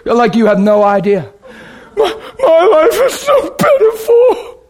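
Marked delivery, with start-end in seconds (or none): 2.0-4.6 much higher, slow crying voice; broken, tearful plea to God; retold sobbing. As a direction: weep out the confession. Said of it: "crying, like".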